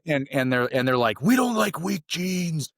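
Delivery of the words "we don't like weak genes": high, slow, drawn-out mock yell; imitated instructor yell. Instructors to the trainee they think defective, imitated yelling. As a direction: jeer it like an instructor, stretched out.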